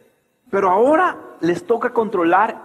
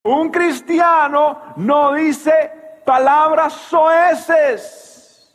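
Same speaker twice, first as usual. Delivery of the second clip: higher, slow preaching at full force; 8 semitones higher, 4 dB louder, much slower than usual. Acted. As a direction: drive the point home from the pulpit.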